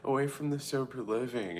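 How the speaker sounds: high, mock bubbly voice; mocking them. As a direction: sing it out like excited party girls. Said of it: "they were like talking about".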